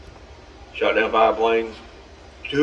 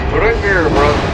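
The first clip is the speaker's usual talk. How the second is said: higher, pushier urging; urging louder; 4 semitones higher, 5 dB louder than usual. Real, not acted. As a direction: push him harder. Urging his ship on.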